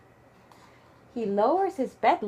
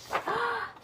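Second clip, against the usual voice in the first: gasp; 9 semitones higher, 6 dB quieter than usual. Pretend. Reading bedtime book to child.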